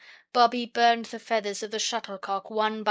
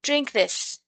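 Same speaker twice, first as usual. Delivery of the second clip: firm; 7 semitones higher, 3 dB louder than usual. A narrator's reading. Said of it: "said Peter firmly".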